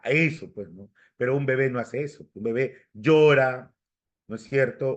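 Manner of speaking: ordinary talk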